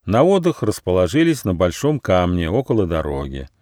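plain reading voice

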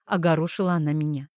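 plain reading voice

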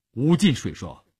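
ordinary delivery

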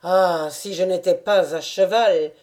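exclaiming. A narrator's reading. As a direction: burst out wishing to join. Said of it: "s'écria Taras".

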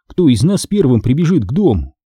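ordinary delivery, nothing marked

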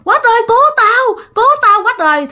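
very high, wailing cry for rescue; imitated screaming for help. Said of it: "nó la làng vậy nè". (giả giọng la làng kêu cứu)

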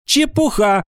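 high, curt rebuff; cutting dismissal. A narrator's reading. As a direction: cut the speaker off. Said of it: "отрезала Ира Сыркина". (отрезать, высоким голосом)

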